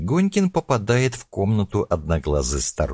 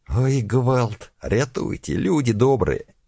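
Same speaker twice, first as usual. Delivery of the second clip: put-on high panicked old woman crying for help; shrieking grandma calling out; frightened old woman; 9 semitones higher, 3 dB louder, slower than usual.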